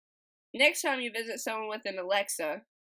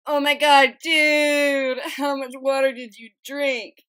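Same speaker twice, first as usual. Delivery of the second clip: loud, high stoner amazement, words drawn out; hammed-up astonished monkey; astonished punchline; 6 semitones higher, 14 dB louder, slower than usual. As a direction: belt out the joke's punchline in disbelief.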